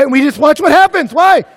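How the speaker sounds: loud, high-pitched build; shouted preaching. Real, not acted. Preacher asking students why everyone watches, shouting. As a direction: punch it out at full voice.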